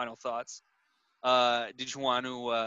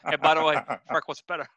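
mock taunt; 2 semitones higher, 6 dB louder, faster than usual. Taunting Pacino in a voice.

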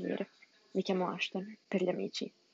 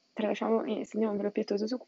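ordinary delivery

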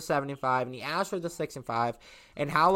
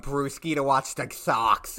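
usual speaking voice

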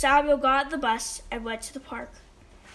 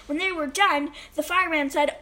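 ordinary delivery, nothing marked

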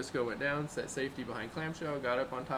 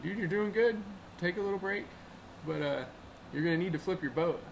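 raised, higher voice calling across the river; encouraging shout over the rapids; 7 semitones higher, 4 dB louder than usual. Retold or imitated. Guide yelling encouragement to the stuck old man. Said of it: "was able to yell at him".

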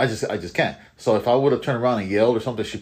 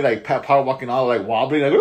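ordinary delivery

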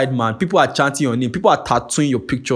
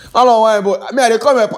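shouting; 10 semitones higher, 5 dB louder than usual. Real, not acted. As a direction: call it out with real excitement. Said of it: "Let me not even be shouting".

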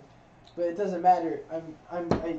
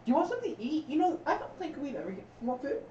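doting pet talk; 10 semitones higher than usual. Acted. Talking to knight like pet.

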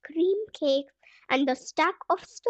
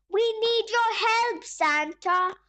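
high, pleading wail; 6 semitones higher than usual. Acted. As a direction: wail for help. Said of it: "wailed Paddy".

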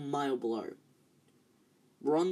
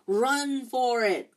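slow, much higher and louder command; urgent drawn-out shout; 8 semitones higher, 7 dB louder, much slower than usual. A narrator's reading. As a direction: shout to escape the tree. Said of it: "Ron shouted".

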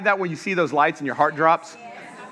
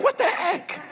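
mock indignant; 13 semitones higher, 4 dB quieter, slower than usual. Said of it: "I'm like".

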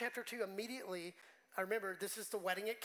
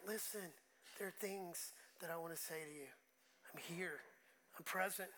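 much softer, gentle and intimate; soft voice of God; 3 semitones lower, 7 dB quieter than usual. Retold or imitated. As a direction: speak it quietly, like a close whisper of comfort. God's voice retold.

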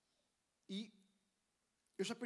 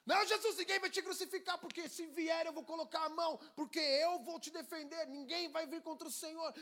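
much higher and louder, fierce; fervent shout; 8 semitones higher, 7 dB louder than usual. Retold or imitated. Pedro fiercely promising Jesus to defend him. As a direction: shout your loyalty. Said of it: "Quando Pedro estava cheio de Jesus".

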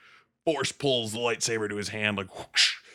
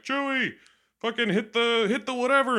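much higher, louder call to the cockpit; mock yell; 4 dB louder than usual. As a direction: shout down at Chewie from the roof. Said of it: "he's yelling down to him".

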